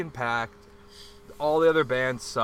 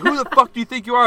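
much higher, louder and fast; retold bartender yell; 11 semitones higher, 10 dB louder, much faster than usual. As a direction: yell back at the customer.

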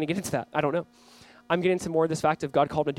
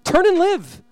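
high, loud, drawn-out plea; impassioned preacher voicing God's plea; pleading; 11 semitones higher, 5 dB louder, much slower than usual. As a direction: cry it out as an urgent appeal. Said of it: "Do you not hear God pleading?".

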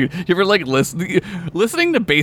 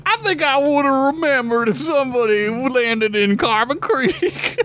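incredulous Southern guy; 12 semitones higher than usual. Retold or imitated. Voicing Tucker mocking.

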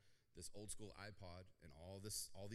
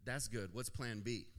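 lightly voiced parent, louder; dismissive parent; 8 dB louder, slower than usual. Parent answering a child's shared dream, mock dismissiveness. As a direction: imitate a parent firmly brushing off a dream. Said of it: "what parents always say".

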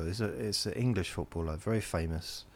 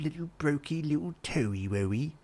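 mocking baby talk; 8 semitones higher than usual. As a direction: coo it like baby talk to belittle the injury.

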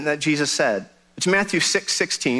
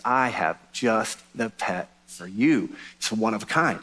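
lower, slow, hammed-up shopkeeper voice; low slow clerk; 7 semitones lower, 4 dB quieter, slower than usual. The pet store clerk offering a rare pet, low and slow. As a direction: play the clerk low and drawn out.

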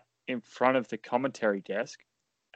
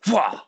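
mock yell; 8 semitones higher, 6 dB louder than usual. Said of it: "Nia goes".

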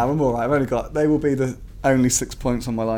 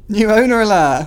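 higher and louder jingle-style announcement; mock news alert; 6 semitones higher, 6 dB louder than usual. Host announcing the club takeover like a news alert. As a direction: call it out like a breaking news sting.

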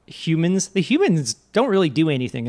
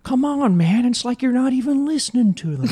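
Marmaduke voice; 8 semitones higher than usual. Retold or imitated. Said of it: "Marmaduke's like".

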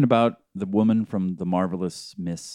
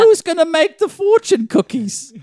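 mock Chinese accent; 6 dB louder than usual. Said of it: "I'm offended by your Chinese accent".

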